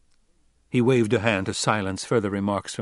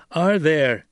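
sharp, loud and higher-pitched; barked question; 7 semitones higher, 7 dB louder than usual. A narrator's reading. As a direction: bark it out as a demand. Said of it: "Paul barked".

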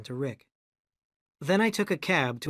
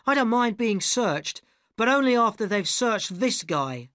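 higher, slow pointed accusation; accusing actress; 8 semitones higher, 4 dB louder than usual. A narrator's reading. Arabella accusing the narrator before the crowd. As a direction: point the finger at him.

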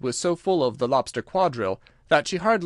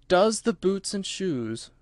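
slow, higher, puzzled echo; wondering; 6 semitones higher, slower than usual. A narrator's reading. Thoroughly puzzled Alice repeats the answer, slow and wondering. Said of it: "repeated in a wondering tone".

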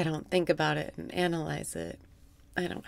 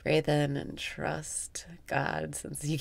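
slow whispered guidance; whispered instruction; 4 semitones lower, 6 dB quieter, slower than usual. Acted. Guiding the in-breath in a slow whisper. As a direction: whisper it slowly.

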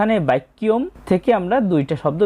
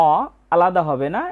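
ordinary talk

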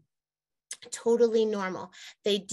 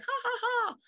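mock laugh. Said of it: "I'm like".

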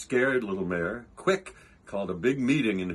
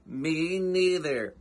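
slow, higher-pitched joey voice; young kangaroo; 4 semitones higher, slower than usual. A narrator's reading. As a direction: draw it out like a little echoing sidekick. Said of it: "the young kangaroo in her pouch said".

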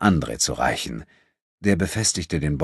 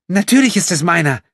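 high, loud furious retort; hissing back; 10 semitones higher, 5 dB louder than usual. A narrator's reading. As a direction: spit it at him while struggling. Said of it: "fauchte Corinna".

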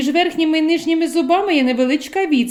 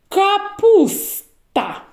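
Капуста drawn out slowly, each sound clear; slow, stretched word; 8 semitones higher, much slower than usual. Shown as a demonstration. Teacher stretches the word for children to check for Н, slowly. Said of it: "коли почуєте звук Н в словах".

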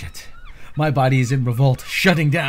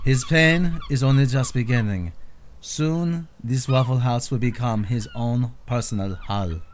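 hushed nature documentary. Acted. Narrator foretells his suffering.

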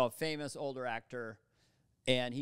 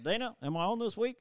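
lightly voiced Obama, higher; mild Obama impression; 7 semitones higher, 3 dB louder than usual. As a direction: Obama asking Dana, a hint of his cadence. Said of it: "Obama's always invading my podcast".

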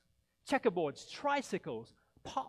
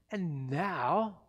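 gleeful grin; 9 dB louder than usual. Acted. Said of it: "grinned the Grinch".

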